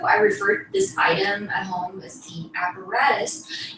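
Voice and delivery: ordinary talk, nothing marked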